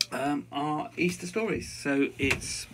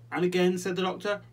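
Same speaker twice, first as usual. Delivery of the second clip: usual speaking voice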